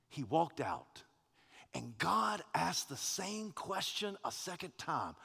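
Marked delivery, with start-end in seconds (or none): none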